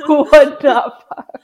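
laughing echo. Real, not acted. Repeating cohost's punchline, loud slow laughter. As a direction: savor each word while cracking up.

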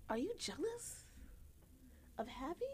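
high, disbelieving question; incredulous question. Retold or imitated. Asking the jealous guy if he's serious, incredulous and high.

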